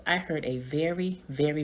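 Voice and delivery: plain reading voice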